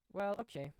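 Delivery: ordinary talk, nothing marked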